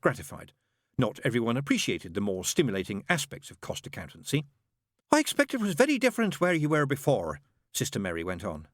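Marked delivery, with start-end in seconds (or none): 5.1-7.4 high, bright-voiced chattering nun; fussy nun. A narrator's reading. Nun greeting the father in a high voice. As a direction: pitch it high like a flustered nun. Narrator voices Sister Mary.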